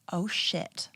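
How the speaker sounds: softly stunned, re-enacted curse; stunned swearing. Woman re-enacts seeing the pregnancy test, quietly stunned. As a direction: let the curse drop out quietly. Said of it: "And I am in shock".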